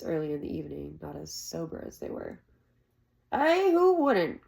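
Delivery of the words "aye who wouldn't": put-on high, slow, knowing old man's aye; judicious agreement. Old Cobb agreeing knowingly with Shep, high and slow. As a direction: draw it out like a sage. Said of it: "Old Cobb said judiciously".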